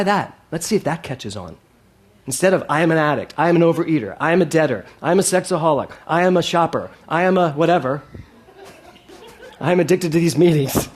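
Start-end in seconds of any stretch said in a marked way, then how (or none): 2.7-10.9 listing labels in a mock confessional tone; mock meeting confession. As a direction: parody the addict roll call, louder. Mocking twelve-step self-labels.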